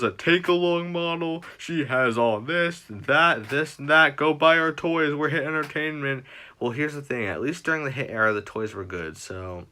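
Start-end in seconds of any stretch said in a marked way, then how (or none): 0.0-6.2 louder, hammed-up greedy company voice; mock corporate pitch. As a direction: parody a toy company pushing sales. Mocking the company's sales pitch.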